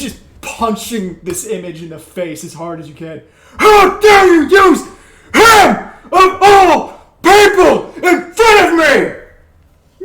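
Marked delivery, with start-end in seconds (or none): 3.6-10.0 high, slow bellow in bursts; furious scream. Acted. Barbarian pummeling an impostor of his mentor, screaming in outrage. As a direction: roar it out word by punched word. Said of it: "begins screaming".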